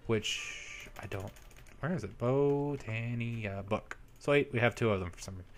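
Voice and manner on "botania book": slow, stretched-out syllables, softer; drawn-out searching. Real, not acted. Player hunting for the Botania book, drawn out.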